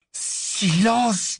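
high, strained command; urgent hushing. A narrator's reading. Harry silencing his screaming friend in the cellar, urgent hushing. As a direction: cut through his panic with an urgent order.

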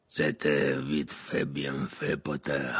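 low, slow growling grumble; growled. A narrator's reading. Maugrey grudgingly praising Harry, low growl. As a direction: growl your approval. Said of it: "grogna-t-il".

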